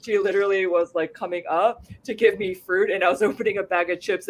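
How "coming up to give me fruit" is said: ordinary talk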